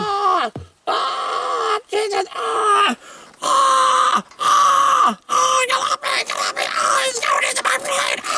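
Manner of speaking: shrieking, high and panicky, fighting off worms; hammed-up screaming for help; mock terrified screams. Reviewer acting out a worm attack, shrieking in hammed-up terror.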